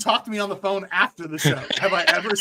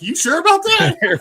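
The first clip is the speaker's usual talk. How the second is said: skeptical quote; 12 semitones higher, 5 dB louder than usual. Retold or imitated. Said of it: "I'm like".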